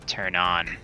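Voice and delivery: usual speaking voice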